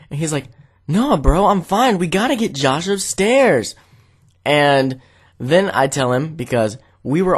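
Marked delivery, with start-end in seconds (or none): 0.9-3.7 raised, insistent sleep-talker; imitated urgency. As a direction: press it on a friend, mock urgent. Imitating frantic sleep-talking friend.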